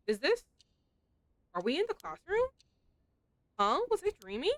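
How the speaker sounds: high, puzzled waking voice; a hint of a dazed schoolgirl; lightly voiced confused girl. Yako waking in the classroom, high and puzzled.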